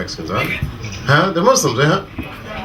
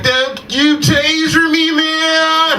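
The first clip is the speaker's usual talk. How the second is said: pleading yell; 10 semitones higher, much slower than usual. Retold or imitated. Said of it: "He was like".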